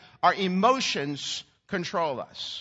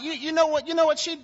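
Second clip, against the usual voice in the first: pitched-up gossipy phone complaint; mock gossip; 9 semitones higher, faster than usual. Mimicking a churchgoer gossiping on the phone. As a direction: dish the dirt on her like a gossip. Gossiping call about someone.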